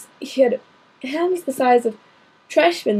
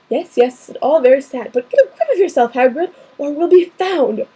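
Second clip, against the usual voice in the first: ordinary delivery, nothing marked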